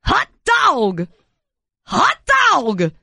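Harry Carey hot dog. Acted. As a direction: bark it like an old-time ballpark announcer. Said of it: "like Harry Carey".

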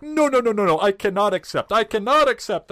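put-on high, loud, insistent refusal in a Middle Eastern accent. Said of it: "I am gonna do the accent here".

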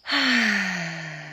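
long sigh of relief; drawn-out exhale of relief; relieved sigh. A narrator's reading. Relief at finding no mouse.

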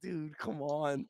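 slow, soft exasperated sigh of a plea. Retold or imitated. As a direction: drag it out, fed up. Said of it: "And I'm like".

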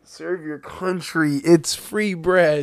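slow, drawn-out sales pitch; slow salesy pitch. Pretend. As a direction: sell it slowly and proudly. Pushing military pitch.

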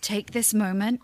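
ordinary talk

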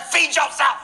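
high, frazzled parent's snap; exasperated high-pitched outburst; frantic snapping. Retold or imitated. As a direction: fire it back at hungry kids, panicked. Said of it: "like, freaking out".

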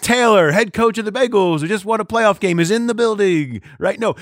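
high, loud DJ introduction; bar emcee announcement. Acted. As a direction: welcome the guest of honour over the mic. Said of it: "how about a nice".

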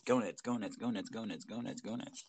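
looping fading glitch; glitchy jumping pitch. Shown as a demonstration. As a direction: repeat like a broken stream. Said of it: "my glitchy ass voice".

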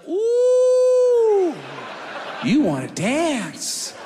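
high squealed ooh, then slow teasing; playful challenge; mock taunt. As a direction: tease him, stretching the words. Taunting the driver.

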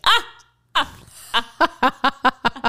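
high manic cartoon cackle; insane cartoon laugh. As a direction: cackle like a vintage cartoon character. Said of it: "these insane laughs".

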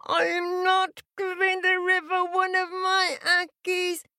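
put-on high stubborn mean-girl voice; defiant, mean little girl; mean girl shout. A narrator's reading. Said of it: "and shouted".

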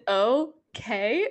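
imitated Spanish-accented okay. Mimicking how Grace said okay last night, Spanish accent. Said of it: "in Spanish".

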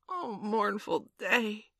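long drawn-out, high wail; lament.

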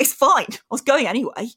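retold passive-aggressive brush-off. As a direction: insist with a prickly edge. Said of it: "really passive aggressive with him".

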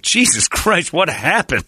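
high exasperated yelp of disbelief; mock shock at the score. Coming back to the TV, shocked exclamation. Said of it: "I'm like".

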